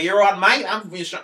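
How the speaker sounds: hammed-up Australian impression; Australian accent. Shown as a demonstration. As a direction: exaggerate a brash Australian accent. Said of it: "talking about my Australian supply teacher".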